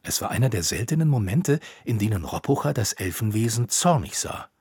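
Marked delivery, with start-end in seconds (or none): none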